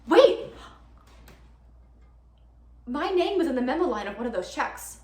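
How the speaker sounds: loud, high cry, then tumbling out; sudden realization. Acted. Girlfriend realizing her name was on a check, astonished. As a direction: burst out as it dawns on you.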